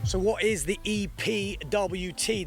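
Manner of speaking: normal talking voice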